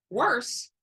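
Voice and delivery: ordinary delivery, nothing marked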